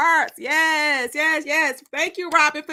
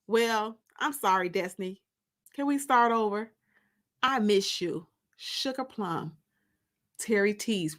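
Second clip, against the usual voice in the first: steady, lower reading voice for the book; story narration; 4 semitones lower than usual. Acted. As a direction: read the chapter like an audiobook narrator. Reading her novel aloud.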